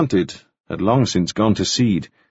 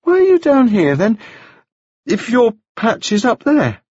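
put-on much higher, bright boyish voice; high bright young gentleman; 12 semitones higher, 3 dB louder than usual. A narrator's reading. Wounded Staines greeting Taufari, high and bright. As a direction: lift it up high and bright like a dazed young toff. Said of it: "speaking in a voice that was high and very bright".

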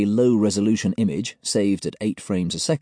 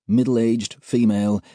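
plain reading voice

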